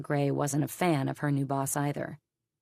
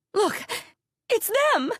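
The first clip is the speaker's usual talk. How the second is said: high excited shout from across the room; 6 dB louder than usual. Acted. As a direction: call out to the others, much higher and louder. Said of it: "The shout had come".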